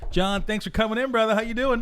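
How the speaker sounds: high, loud hyped greeting; excited welcome. Real, not acted. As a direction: greet him like a big star. Host greeting guest with hype.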